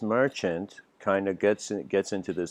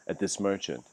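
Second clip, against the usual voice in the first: ordinary talk, nothing marked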